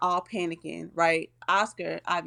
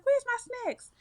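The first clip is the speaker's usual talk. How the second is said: squeaky high pitch jump, mock indignant; outraged high question. Penny seeing Puff flying with her snacks, squeaky outrage. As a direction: demand it in a comic high squeal. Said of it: "She's like".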